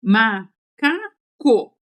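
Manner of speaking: "macaco" very slow, ma... ca... co; three stretched beats with gaps in "macaco"; syllable by syllable. Shown as a demonstration. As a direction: sound out "macaco" like a child writing it, one syllable at a time. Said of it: "ela faz assim".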